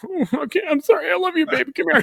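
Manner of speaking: squeaky high mock-parent voice caving in; mock doting parent. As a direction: gush over the kid like a pushover parent. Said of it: "You're like".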